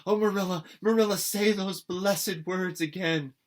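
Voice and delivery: put-on a girl overjoyed her guardian relents; ecstatic. A narrator's reading. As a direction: burst with joy at the news. Anne bursting with joy.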